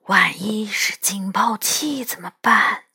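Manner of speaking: worried hushed whisper. Acted. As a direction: whisper it hesitantly. Hiding near a guarded door.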